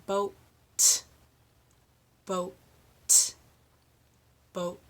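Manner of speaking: BOAT with the T released apart, each time; slow drill, pop the t ending each boat; clear final T. Shown as a demonstration. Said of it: "So let's try that three times".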